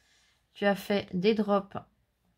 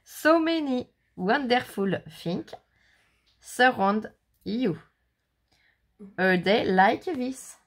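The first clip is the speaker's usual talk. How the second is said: slow, careful English read with a French accent; slow French-accented English, read carefully; 3 semitones higher, 4 dB louder, slower than usual. Shown as a demonstration. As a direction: show how it should be read, slowly. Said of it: "t'as voulu prendre l'accent anglais".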